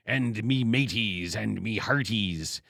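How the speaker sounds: slow, hammy pirate talk. Retold or imitated. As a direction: ham up an old pirate voice. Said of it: "Wallace Beery with the parrot".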